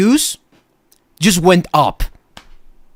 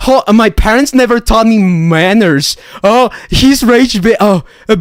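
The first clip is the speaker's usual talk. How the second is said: higher, whiny mock voice of a rude kid; mocking chat troll; 6 semitones higher, 4 dB louder than usual. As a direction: sneer it like the brat you're quoting. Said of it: "that's how you sound".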